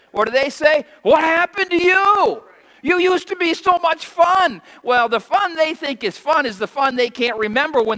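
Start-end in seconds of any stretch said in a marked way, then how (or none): 1.0-4.6 high, loud sneering taunt; shouted mock of old friends teasing a convert. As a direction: jeer like the old party crowd. Mocking voice of worldly friends.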